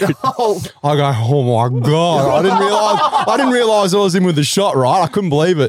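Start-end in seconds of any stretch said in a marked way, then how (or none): 0.8-2.4 stunned. Retold or imitated. Stunned reaction retold. 2.4-4.8 higher, laughing through the words. Real, not acted. Telling mates how the night went, laughing through it.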